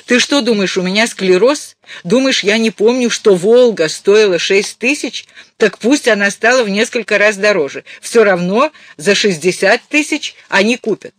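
high, loud argumentative mother; irate, insistent phone tirade; angry mother. Acted. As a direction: argue back at full tilt. Angry mother keeps arguing.

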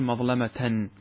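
مظلمة said with its final tanween, "mazlamatan"; full case ending. Shown as a demonstration. Teacher shows the unpaused form, مظلمة ending in "-tan". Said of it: "الأصل أن يقول".

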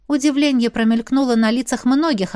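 ordinary delivery, nothing marked

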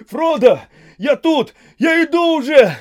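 frantic high full-voiced shout; desperate call across the water. A narrator's reading. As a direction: yell out at the top of your voice while running. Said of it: "кричал он с разбегу".

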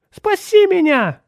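put-on very high, loud boy's scream; screaming for help. A narrator's reading. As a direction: scream for rescue, drawn out. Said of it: "закричал он". (отчаянный крик о помощи)

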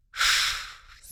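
soft, voiceless "shhh" hiss; long hushing hiss of a cat. A narrator's reading. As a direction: hiss quietly like a displeased cat. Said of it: "зашипела та".

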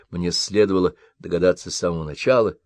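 usual speaking voice

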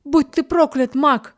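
high, loud cry of defiance; defiant shout. A narrator's reading. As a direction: hurl the curse back at him. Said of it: "крикнула в ответ девушка".

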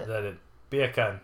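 BEER CAN said like "bacon", Jamaican style; mock Jamaican accent on "beer can". Shown as a demonstration. Testing the beer can joke on co-host, Jamaican accent. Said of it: "it sounds like bacon in Jamaican".

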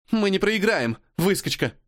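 high, ringing, defiant declaration. Acted. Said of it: "звенящим голосом". (звенящий голос)

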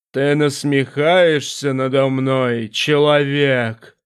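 low, slow, echoing demon voice; a vast presence speaking from the dark; booming demon voice. Acted.

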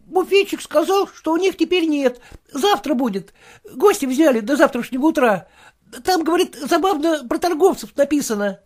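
put-on a young servant reporting dutifully; errand boy. The boy reports back to his master, in a high boy's voice.

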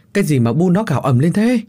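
jolted into asking what the screaming is; startled demand. A narrator's reading. Husband rushing to his screaming wife, startled demand. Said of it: "giật giọng hỏi".

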